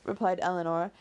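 ordinary delivery

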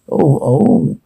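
normal talking voice

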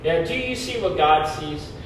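ordinary talk, nothing marked

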